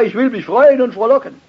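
high, loud, fast exclamation; preaching at full force. Acted. Preacher proclaiming David's defiant joy, loud and high.

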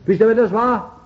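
loud, high challenge to the congregation; insistent repeated question. Real, not acted. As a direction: call the question out, demanding an answer.

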